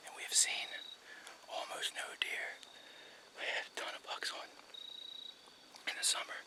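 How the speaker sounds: hushed hunter's whisper. Real, not acted. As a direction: whisper so the deer can't hear. Whispering in the hunting stand.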